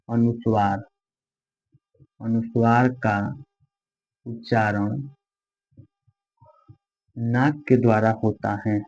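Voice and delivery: slow dictation with long pauses between words. Acted. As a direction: read each word out so students can write it. Teacher dictates notes slowly. (धीमे, रुक-रुक कर लिखवाना)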